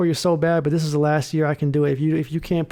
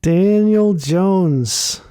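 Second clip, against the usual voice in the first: long, slow, louder groan of the name; drawn out; 3 semitones higher, 4 dB louder, much slower than usual. Real, not acted. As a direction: stretch the name in exasperation. Drawn-out lament over the quarterback.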